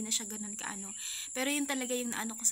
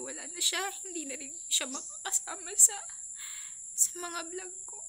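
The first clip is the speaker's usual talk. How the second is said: tearful; 8 semitones higher than usual. Real, not acted. Grieving daughter on her father's death.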